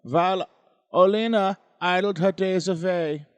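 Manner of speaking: dramatic storyteller in a mock German accent. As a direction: read it with a hammed-up German accent, punching phrases. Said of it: "I'm doing a German accent".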